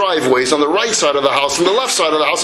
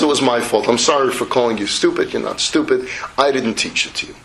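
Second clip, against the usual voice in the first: sincere apology; 8 semitones lower than usual. Acted. Father says sorry to his son, low and gentle.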